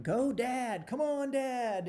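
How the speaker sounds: high, drawn-out mock cheer from the beach; imitating kids yelling encouragement; kids hollering. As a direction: call it out like boys cheering their dad on. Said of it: "they start hollering".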